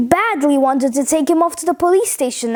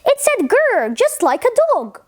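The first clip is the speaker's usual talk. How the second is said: put-on higher, fussy old lady voice; old lady alarmed, telling a policeman, high; 7 semitones higher than usual. A narrator's reading. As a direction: report it like a flustered old lady. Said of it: "she told him".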